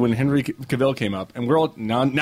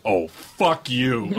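slow, drawn-out retort; mock indignation; much slower than usual. Director answers a teasing fan, slow mock indignation. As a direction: imitate someone playfully offended, dragging out each word.

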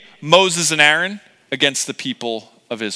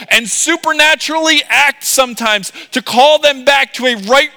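high, loud, rising sermon climax; impassioned preaching; 11 semitones higher, 7 dB louder than usual. Real, not acted. Preacher proclaims God's unchanging pursuit of people, impassioned. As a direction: build from firm to soaring proclamation.

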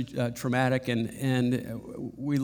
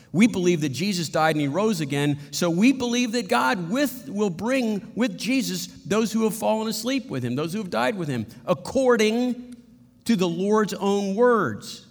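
scripture reading; 7 semitones higher than usual. Acted. Reads scripture with rising intensity.

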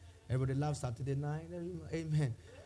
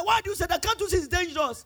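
alarmed protest; 13 semitones higher, 8 dB louder than usual. Retold or imitated. Woman challenges him in turbulence.

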